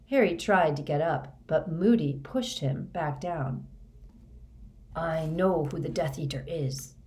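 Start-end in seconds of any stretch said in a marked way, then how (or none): none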